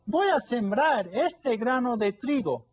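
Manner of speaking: bright storybook hen; little hen voice. Acted. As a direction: pipe it up like a small busy hen.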